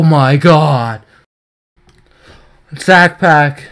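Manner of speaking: ordinary talk, nothing marked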